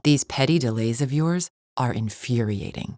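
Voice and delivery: usual speaking voice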